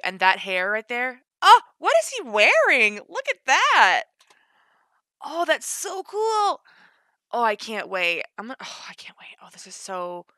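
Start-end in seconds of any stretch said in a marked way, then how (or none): 1.4-6.6 high, loud burst of delight; excited squeal. Real, not acted. Reacting to an outfit in the trailer, excited squeal. 8.4-10.3 hushed, breath-only delight; excited whisper. Real, not acted. Fan who can't wait for the game, excited whisper. Overwhelmed fan watching trailer.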